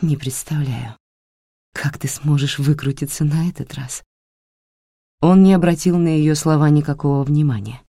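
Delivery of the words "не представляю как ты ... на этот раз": quiet and sad. Acted. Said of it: "голос звучал тихо и грустно".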